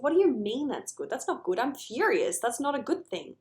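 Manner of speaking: mock outrage at being told anger is good; indignant protest. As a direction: client protesting that fury is no good thing. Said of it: "And he said".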